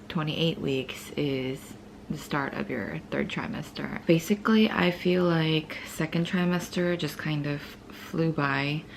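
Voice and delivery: hushed morning chat, barely voiced. Real, not acted.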